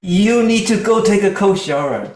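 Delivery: very loud, high-pitched outburst; angry retort. Shown as a demonstration. Coach showing what escalates a quarrel, angry yell. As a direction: yell it as an accusation.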